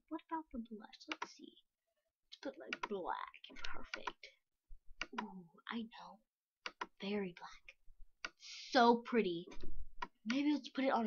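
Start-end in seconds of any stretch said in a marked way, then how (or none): 0.1-7.8 soft toneless whisper. Real, not acted. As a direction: murmur it to yourself in a whisper. Kid whispering while designing face.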